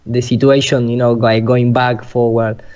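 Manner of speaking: ordinary talk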